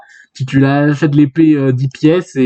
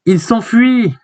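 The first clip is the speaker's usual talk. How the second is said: high-pitched alarmed call; acted alarm; 8 semitones higher, slower than usual. Character warning that the dragon flees, high acted alarm.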